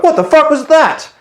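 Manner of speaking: high, loud incredulous blast; retold outburst at the mechanic who let cash slip; angry outburst. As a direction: explode at him in disbelief. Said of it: "I went fucking apeshit".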